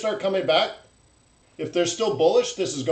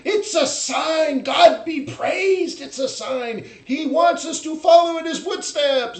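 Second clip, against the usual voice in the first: mock Life of Brian mob shouting it's a sign; frenzied mob follower; 11 semitones higher, 4 dB louder than usual. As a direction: proclaim the sign like a swept-up believer. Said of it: "The Life of Brian".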